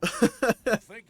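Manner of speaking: a quick amused laugh; real chuckle before complaining; short laugh. Real, not acted. Laughs about Assassin's Creed.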